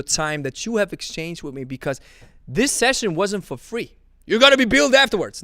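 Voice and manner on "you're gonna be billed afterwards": mock threat. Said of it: "No, I'm joking".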